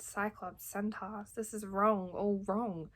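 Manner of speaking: usual speaking voice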